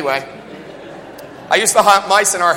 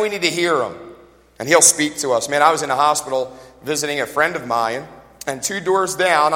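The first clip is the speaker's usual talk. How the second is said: normal talking voice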